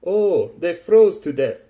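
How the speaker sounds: slow, loud, high discovery; amazed realization. A narrator's reading. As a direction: blurt out a dumb revelation. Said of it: "uttered it vocally".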